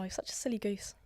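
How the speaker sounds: hushed playful jab; teasing whisper. Retold or imitated. Teasing slang example.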